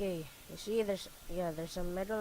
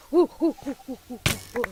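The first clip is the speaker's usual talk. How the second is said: excited whooping; 6 semitones higher, 13 dB louder, faster than usual. Real, not acted. Cheering in game fight.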